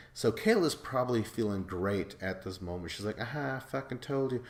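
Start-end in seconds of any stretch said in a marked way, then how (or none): 3.2-4.4 mock Kayla. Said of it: "that's not a good Kayla impression".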